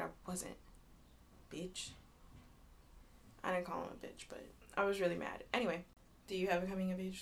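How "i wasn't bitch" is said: muttered under breath. Retold or imitated. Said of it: "I said".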